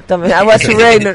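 put-on slow Chilean-accented broken English. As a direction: fumble through bad English. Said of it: "hablar en inglés inventado". (inglés chapurreado con acento chileno)

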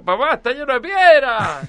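put-on high, loud, slow child's whine; whiny kid. Kid complaining at cold lake.

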